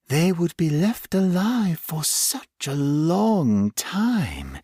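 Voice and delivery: a hint of a sinister creature reminiscing; menacing creature. Creature speaking to its captive.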